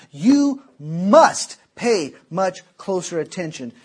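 slow, deliberate weight on "must"; emphatic command. Real, not acted. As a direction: spell out the order word by word, stressing "must". Preacher hammers the word must.

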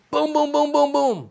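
loud, high rapid-fire booms; booming door pounding. Retold or imitated. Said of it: "Somebody's just banging my door down".

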